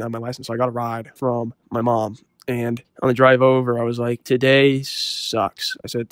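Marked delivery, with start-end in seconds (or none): 4.2-5.8 slow gloomy groan. Retold or imitated. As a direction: stretch it out, very slow and sulky. Sulking to mom in car.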